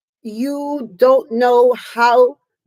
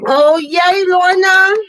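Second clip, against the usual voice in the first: excited; 5 semitones higher, 6 dB louder, slower than usual. Real, not acted. Joyful greeting to a viewer.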